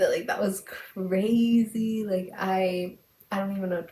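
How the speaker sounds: loud, drawn-out exclamation; retold amazement. Girl telling her friends after leaving the elevator, retold amazement. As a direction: stretch out "crazy" in overwhelmed disbelief.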